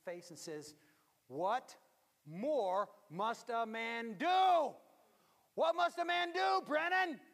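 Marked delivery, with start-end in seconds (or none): 1.3-7.2 put-on loud, slow, high scolding of an old mother; irritated shout. Mother scolding Brennan in a loud, high shout. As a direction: shout it at him, finger in his face.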